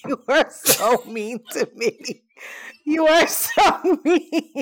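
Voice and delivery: slow, drawn-out words through real laughter; helpless laughing while protesting. Real, not acted. To a friend who is teasing, laughing protest.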